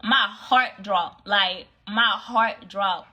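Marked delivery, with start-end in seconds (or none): none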